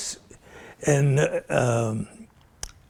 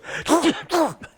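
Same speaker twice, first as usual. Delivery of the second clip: demo cough; 12 semitones higher than usual. Shown as a demonstration. And I was catching cold.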